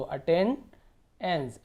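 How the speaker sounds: "Attendance" very slowly, pausing before the final एंस; split at suffix. Shown as a demonstration. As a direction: break "attendance" to set off the ending. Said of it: "या फिर एंस पढ़ सकते हो".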